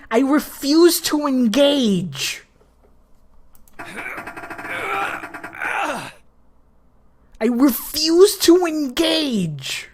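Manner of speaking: slow, high, drawn-out protest; emphatic, dragged-out refusal; stubborn refusal. Real, not acted. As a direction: stretch each word in indignant defiance.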